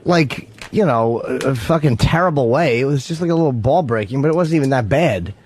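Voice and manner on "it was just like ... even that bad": ordinary talk, nothing marked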